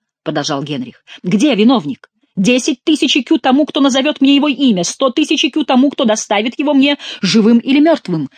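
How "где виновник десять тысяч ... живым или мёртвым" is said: high, louder, driving proclamation; heated demand. A narrator's reading. Furious king offering gold for the culprit, demanding. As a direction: press every offer hard, pitched up.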